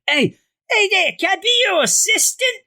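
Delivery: very high, eager little-guy voice; squeaky comical sidekick. Retold or imitated. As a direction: pipe up like a pushy cartoon kid. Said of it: "such a comical little character".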